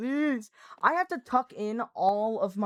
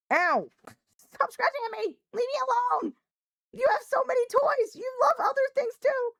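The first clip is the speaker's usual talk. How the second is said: high, loud protest at the scratching cat; pained high yell; 4 dB louder than usual. Real, not acted. Streamer yelping and scolding her cat for scratching, high and loud.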